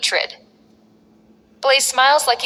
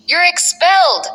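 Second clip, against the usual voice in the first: loud, higher furious yell, voice breaking; cracking with rage; 8 semitones higher, 5 dB louder than usual. A narrator's reading. General manager expelling Blaze, furious cracking shout. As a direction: explode at him, voice cracking. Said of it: "The G M's voice cracks with rage".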